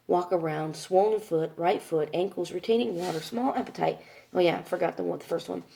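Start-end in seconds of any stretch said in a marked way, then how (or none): none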